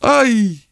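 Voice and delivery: very high startled squeal; shriek. A narrator's reading. Wife startled by the young man, high shriek. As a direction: cry out high in fright. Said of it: "diye bir çığlık attı".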